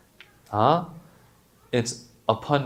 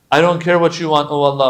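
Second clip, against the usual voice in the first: defiant; 4 semitones higher, 8 dB louder, faster than usual. Retold or imitated. Arrogant sinner voiced in sermon.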